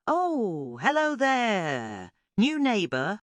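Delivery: high, slow, chirpy greeting; chirpy starfish. A narrator's reading. Stella greeting her new neighbour Terence, chirpy and high. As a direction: sing out a bright hello to the newcomer. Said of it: "she chirped".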